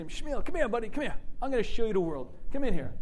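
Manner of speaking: high, coaxing call to a buddy; coaxing an old-world friend into the car. Retold or imitated. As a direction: beckon him in like a friendly host.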